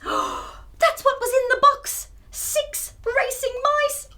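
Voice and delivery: high, astonished exclamation; high surprised reveal. Acted. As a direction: gasp out the discovery. Reveal of mice in box.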